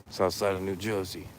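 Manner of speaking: put-on a touch of Jersey street accent on South side of New Jersey; mild New Jersey tough guy. Answering where he's from, a light Jersey accent. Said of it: "That's just how I talk".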